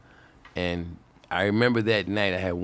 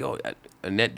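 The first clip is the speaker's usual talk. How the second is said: ordinary talk, nothing marked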